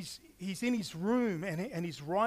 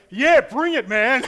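loud, higher, pumped-up yes; urging fire from heaven with a loud, eager shout; 6 semitones higher, 15 dB louder than usual. Retold or imitated. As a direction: egg Jesus on, loud and keen. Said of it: "And I go".

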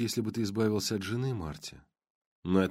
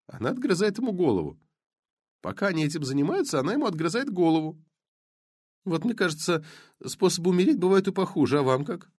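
lightly voiced blonde; 8 semitones higher than usual. Blonde voiced high, faster.

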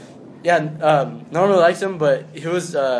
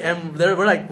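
normal talking voice

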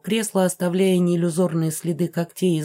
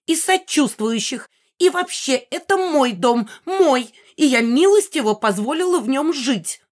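high-pitched imperious outburst; haughty old ghost raging; indignant ranting; 7 semitones higher, 4 dB louder than usual. A narrator's reading. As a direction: claim ownership furiously in a high voice. Megara claims the house, enraged.

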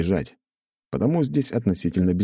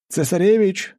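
high, raised call; 4 dB louder than usual. Acted. Hero spotting Alexander nearby, calling out. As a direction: hail someone at a distance. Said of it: "крикнул я".

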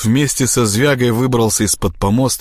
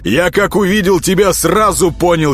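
raised, heated accusation; accusing shout; 9 semitones higher, 3 dB louder than usual. A narrator's reading. Prince accusing the plotter behind him, raised voice. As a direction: start high and indignant, then settle. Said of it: "закричал ему вслед Всеволод".

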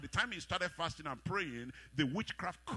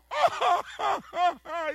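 much higher, louder hammed-up cackle; high mock laugh; 11 dB louder than usual. Uncle laughs at the promise of more, mock laugh.

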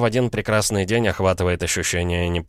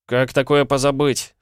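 высокий досадливый ответ; фыркнуть с лёгким раздражением; фыркая; 8 semitones higher, 4 dB louder than usual. A narrator's reading. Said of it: "фыркнул Пол". (раздражённо фыркнуть)

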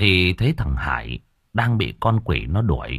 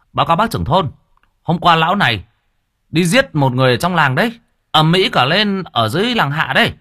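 high, loud, urgent villager; raised, excited villager voice; agitated villager reporting; 9 semitones higher, 3 dB louder than usual. Acted. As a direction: report it to the chief in alarm.

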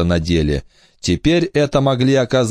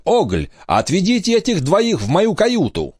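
squeaky captain's order; 11 semitones higher than usual. Acted. Captain orders his crew.

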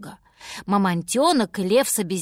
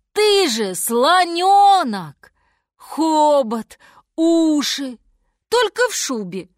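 loud, higher exclamation, drawn out; delighted realisation; 6 semitones higher, 7 dB louder, slower than usual. A narrator's reading. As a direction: announce your discovery emphatically. Lion has sudden realisation.

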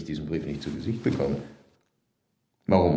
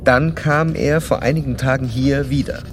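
measured narrator reading a clinical report; formal case narration; 2 semitones higher, 5 dB louder than usual. Acted. Doctor narrating his case study to the listener in a formal reading voice. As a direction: recount the case like reading from your own notes.

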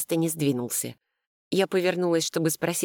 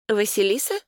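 higher, rising question; questioning echo; 5 semitones higher than usual. A narrator's reading. Sister hears brother's answer, puzzled questioning echo. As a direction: repeat the name as a puzzled question.

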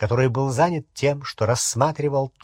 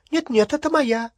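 much higher, flustered protest; surprised denial; 11 semitones higher than usual. A narrator's reading. Hermione insisting to the goblin, surprised. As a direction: deny it in a raised pitch. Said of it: "удивилась Гермиона".

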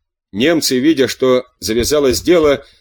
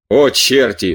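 plain reading voice

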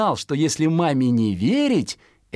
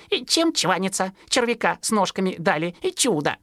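fledgling thinking; 8 semitones higher than usual. Acted. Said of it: "думал".

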